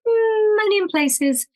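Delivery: long high "mm", sliding down into the words; playful, high-pitched mock objection; coy retort. Singer answering her partner's request to tone it down, coy retort.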